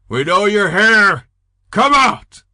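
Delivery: loud, high threatening shout; male shouting. Acted. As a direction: bellow it out at the hidden girls. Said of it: "They heard a male shouting".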